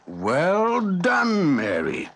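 high, warm praise from the smith; proud praise. A narrator's reading. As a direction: praise her warmly and proudly. Blacksmith praises Mary.

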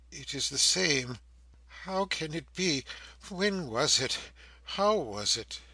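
bewildered prisoner. A narrator's reading. Prisoner studies her hair against his keepsake, bewildered. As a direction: puzzle it out aloud in growing agitation.

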